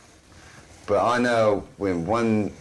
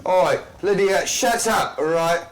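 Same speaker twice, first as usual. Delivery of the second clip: exasperated high voice; 7 semitones higher than usual. Acted. Character scolds hag, comic high voice.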